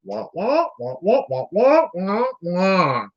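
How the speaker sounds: muted trombone wah-wah voice; Charlie Brown's teacher. Retold or imitated. Imitating a coach's bleeped rant as cartoon teacher wah-wahs. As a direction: mimic the muffled adult drone from the cartoon. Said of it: "it sounded like Charlie Brown's teacher".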